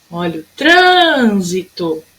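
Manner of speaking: "trânsito" called out with a long tran; chamando, TRAN esticado. Shown as a demonstration. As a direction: hold the first syllable of "trânsito" like a call. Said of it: "Sempre vai chamar a palavrinha". ("trânsito" chamado com o tran bem comprido)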